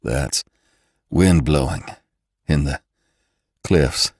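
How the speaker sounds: low, halting, out-of-breath speech; panting. A narrator's reading. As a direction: break the phrase up for breath. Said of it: "he panted".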